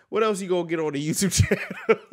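fast, loud words breaking into a laugh. Real, not acted. Host laughing to his viewers after crying, fast and giggly. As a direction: laugh it out to lighten the mood.

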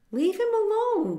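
high, loud protective cry; fierce shout. A narrator's reading. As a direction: shout to drive the bully off. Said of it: "the duckling's mother said fiercely".

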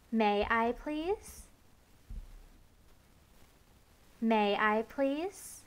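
slow, earnest asking; slow polite request. Shown as a demonstration. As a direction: ask gently, taking your time. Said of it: "Look me in the eye".

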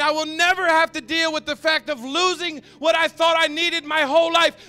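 high, loud, sustained proclamation; full-force preaching. Acted. Preacher closing his story to the congregation, full-force preaching. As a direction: ring it out to the back of the room.

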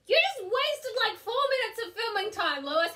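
high-pitched sibling outrage; indignant complaint. Real, not acted. Vlogger blames brother for interrupting filming, indignant complaint. Scolding brother over wasted time.

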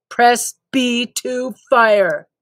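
slow mechanical game instructor; slow canned drill-sergeant prompt; robotic tutorial prompt. Acted. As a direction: repeat it like a looping game line. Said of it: "robotic prompt".

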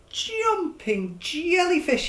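high, slow dazed exclamation; cried out. Acted. Stanley after crashing into the pearl, high slow cry. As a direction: cry it out after bumping your nose. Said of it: "he cried".

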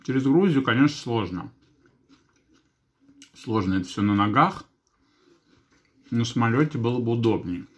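chewing between words; talking while eating salad; mouth full of food. Real, not acted. Seen 0: Bites at -1.0 and +5.0; cheeks full, chewing while speaking.

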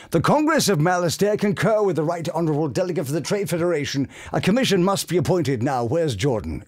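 high, fast put-on alien senator; alien delegate voice. Voice actor recreating his alien senator line on the podcast. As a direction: rattle it off like a pompous alien delegate. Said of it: "you'll hear".

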